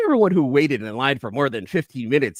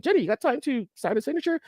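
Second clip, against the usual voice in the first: much higher, fast, put-on eager voice; eager fan voice; faster than usual. Fan asking the artist for a signature, much higher and fast. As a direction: blurt the request in a high, quick, cartoonish voice. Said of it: "I'm like".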